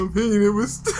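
high voice cracking with laughter; laughing through a ghost voice. Pretend. Ghost bit breaks into laughter between friends. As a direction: try to hold the ghost bit while laughing.

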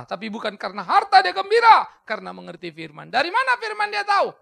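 sermon building to high, loud shouted questions; rousing pulpit delivery with shouted peaks; impassioned preaching. Acted. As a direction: preach with sudden bursts of force. Preaches at full force.

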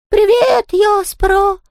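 very high falsetto ghost imitation; high singsong. A narrator's reading. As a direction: sing it out in a mocking high voice. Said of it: "пропел Том высоким голосом".

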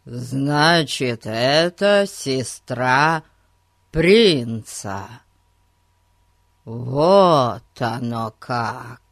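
slow, loud, wide-eyed wonder; amazed. Acted. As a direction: spell out the surprise slowly. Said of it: "воскликнул Эрн".

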